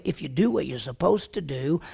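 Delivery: ordinary talk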